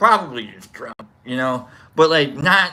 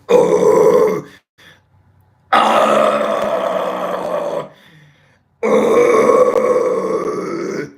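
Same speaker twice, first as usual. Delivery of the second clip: low rasping demon growl; demonic growl; 6 dB louder, much slower than usual. Retold or imitated. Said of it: "summoning demons with her voice".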